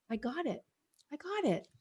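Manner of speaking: soft, high, hushed repeat of a breakthrough; quiet realization. Retold or imitated. Sharing with the host how it finally clicked, said hushed and high. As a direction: breathe it out as the penny drops.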